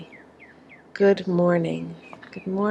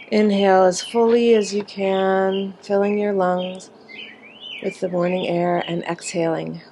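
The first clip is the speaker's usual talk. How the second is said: guided breathing. Acted. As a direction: lead them through long breaths, drawing words out. Leads a group breathing exercise.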